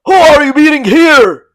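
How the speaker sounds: loud, high commanding call. A narrator's reading. Chai Jin calls out to the vassals, loud and high. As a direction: call out across the yard with authority. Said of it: "the man called".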